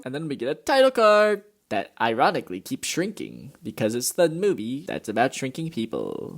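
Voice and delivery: ordinary talk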